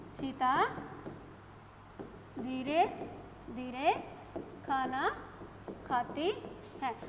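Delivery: each word alone with long pauses; slow dictation, one word at a time. Shown as a demonstration. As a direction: dictate slowly so students can write. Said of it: "मैं अब आपको ये बता रही हूँ".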